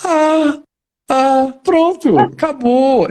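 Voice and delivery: put-on high-pitched child's realisation, slow drawn-out ah; little girl catching on. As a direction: imitate a child who suddenly gets it. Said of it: "Aí ela falou assim".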